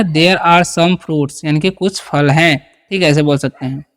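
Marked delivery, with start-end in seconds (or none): 0.0-1.4 slow dictation. Shown as a demonstration. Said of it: "तो सम क्या बोल देंगे?".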